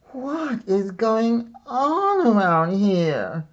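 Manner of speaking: put-on high, slow, fed-up little girl groan; exhausted girl groaning at the odd house. A narrator's reading. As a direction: groan it like a worn-out kid. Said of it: "groaned the exhausted girl".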